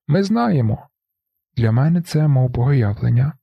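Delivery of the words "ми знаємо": high, urgent shouted reply. A narrator's reading. Marla answering the narrator, shouting. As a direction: yell it back across the roof. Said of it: "Марла кричить".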